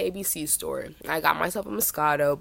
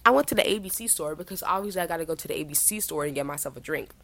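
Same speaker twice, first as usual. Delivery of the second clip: ordinary talk, nothing marked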